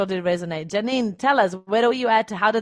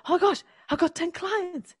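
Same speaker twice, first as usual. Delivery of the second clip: much higher, happily surprised exclamation; delighted surprise; 10 semitones higher than usual. Retold or imitated. Coach voicing a client thrilled by new clients, high delight. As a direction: squeal it out like a shocked new winner. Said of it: "And now all of a sudden you go".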